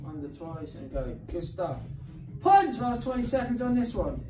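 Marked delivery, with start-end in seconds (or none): 2.4-2.8 sharp loud call, pitched up; shouted cue. Real, not acted. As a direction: bark the cue to the class. Coach cues punch during workout.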